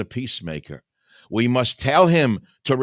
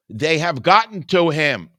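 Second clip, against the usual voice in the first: louder, higher repeat; shouted louder accusation; emphatic shout; 6 semitones higher, 6 dB louder than usual. Real, not acted. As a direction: hammer the accusation home. Repeated charge, second time shouted.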